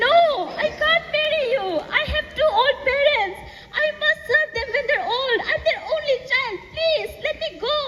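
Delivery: high-pitched, anguished plea; pleading refusal. A narrator's reading. Anuli begs suitor to let her go, pleading.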